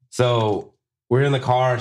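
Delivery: ordinary talk, nothing marked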